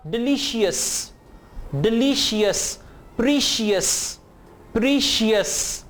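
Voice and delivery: डिलीशियस and प्रीशियस with long "ee" and two-syllable "shee-us" ending; mispronounced -cious. Shown as a demonstration. Teacher shows learners the common mistake, "shee-us" in डिलीशियस. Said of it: "ये दोनों अल्फाज की pronunciation गलत है।".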